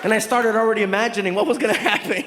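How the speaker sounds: normal talking voice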